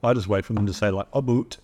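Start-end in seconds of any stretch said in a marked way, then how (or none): 1.1-1.6 mock Canadian accent. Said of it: "like, Canadian".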